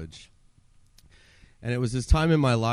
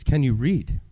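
loud demand; 3 semitones higher, 8 dB louder than usual. Retold or imitated. Huge inmate questioning newcomer.

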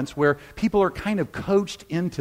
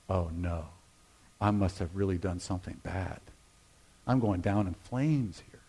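hushed, lower inner panic; worried inner voice; 7 semitones lower, 9 dB quieter than usual. Retold or imitated. Pastor fearing he did something bad, hushed and low. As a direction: mutter the dread to yourself, much softer. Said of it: "my interpretation of that is".

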